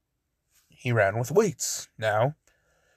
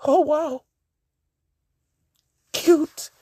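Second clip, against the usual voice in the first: high, lovestruck gasp of delight; a high, dreamy swoon; smitten surprise; 13 semitones higher, 3 dB louder, slower than usual. Acted. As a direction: swoon over her in a high voice. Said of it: "he's just like".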